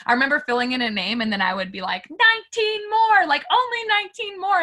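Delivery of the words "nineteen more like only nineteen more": pumping herself up about the nineteen left; eager self-talk. Retold or imitated. Retelling to viewers how she pushed herself, eager high voice.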